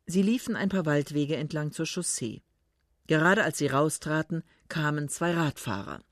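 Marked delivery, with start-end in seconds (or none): none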